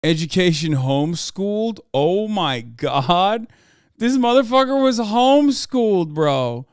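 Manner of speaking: floored, voice jumping high and loud; loud disbelief. Real, not acted. Streamer finds out someone was homeschooled, loud disbelief. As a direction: exclaim it in incredulous shock.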